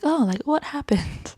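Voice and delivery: high, put-on puzzled voice; high-pitched mock confusion; mock clueless woman. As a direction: imitate her with no memory at all. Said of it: "she obviously doesn't remember anything".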